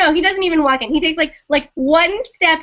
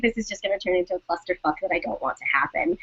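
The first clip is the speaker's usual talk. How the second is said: lower, softer and fast, under her breath; muttered inner thought; 8 semitones lower, 9 dB quieter, faster than usual. Retold or imitated. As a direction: rush it quietly to yourself. Inner thought, quoted to herself.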